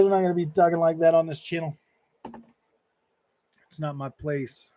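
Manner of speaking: ordinary talk, nothing marked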